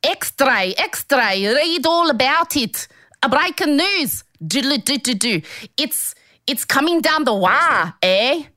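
high hammed-up street newsboy call; newsie paperboy. Hawking the news to the co-host, newsboy style. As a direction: shout the headlines like an old paperboy. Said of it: "Can you do it in the accent?".

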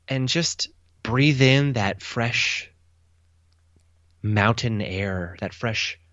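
slow, dreamy guided relaxation; mock soothing. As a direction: lull them like a calm meditation guide. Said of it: "I want you to just sip".

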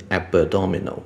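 “abdominal”错念成a-be-dominal; 在“abdominal”的b后插一个轻元音; b后多加母音. Shown as a demonstration. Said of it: "不用念成". (错误示范“abdominal”，b带出母音)

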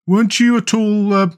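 nervous mole. A narrator's reading. Mole asking the otter about the wild wood, nervous. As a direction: falter before the last word, uneasily. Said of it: "some of yesterday's terror coming back to him".

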